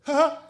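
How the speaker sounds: high, loud teasing ha ha; friend's mocking laugh as he walks away; smug laugh. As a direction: toss off a cocky little laugh. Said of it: "he just goes".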